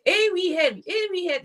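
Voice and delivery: high, halting attempt at Scottish; Scottish accent. Shown as a demonstration. Struggling to do Scottish on request, mock Scottish accent. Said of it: "I can't think of a Scottish".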